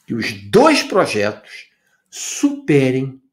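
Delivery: slow reading, leaning hard on key words; deliberate reading. Real, not acted. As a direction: read it out slowly, word by word for attention. Reading a written line out emphatically. (leitura pausada e enfática)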